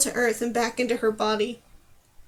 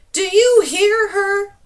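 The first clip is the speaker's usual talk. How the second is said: loud, higher, slow exasperated cry; exasperated shout; 8 semitones higher, 10 dB louder, slower than usual. Acted. Numer appealing to the clouds about Dane, exasperated shout. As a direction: appeal to the heavens in outrage.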